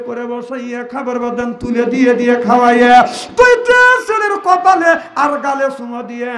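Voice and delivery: sung, surging sermon chant; preaching at full force. Acted. Preacher telling Fatema's death to the gathering, chanting. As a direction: chant the story to the crowd.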